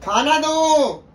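high, loud, sustained yell; demanding shout. Real, not acted. Husband calls his wife to bring food, high shout.

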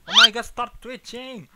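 loud, high shout at the screen; fed-up outburst at the game; exasperated yell. Real, not acted. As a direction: yell in real frustration. Frustrated at glitching character.

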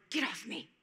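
ordinary talk

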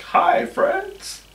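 high, breathy imitation of a puppet. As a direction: squeak it like a hand puppet. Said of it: "you were like".

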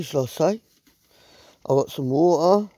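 ordinary talk

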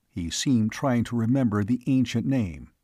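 usual speaking voice

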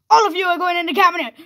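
fast, high, loud threat; play-acted frantic villain yell; villain's shout. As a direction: shout it in a rush as you grab them. Villain captures everyone.

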